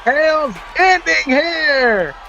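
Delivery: slow, drawn-out announcer call; mock announcer. Mock announcer at match end.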